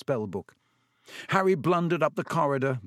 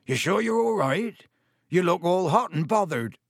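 higher, concerned giant voice; kindly worried check-in; Hagrid voice; 6 semitones higher, 4 dB louder than usual. A narrator's reading.

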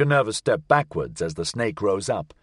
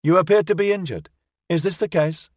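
high, quick robot voice; a chirpy helpful machine; 7 semitones higher than usual. A narrator's reading. Robot voice held.

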